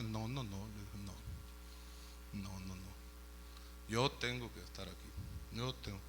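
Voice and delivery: low, hushed refusal; very soft and low, as a servant; quiet insistence. Acted. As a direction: murmur a humble but stubborn refusal. Slave refuses his offered freedom.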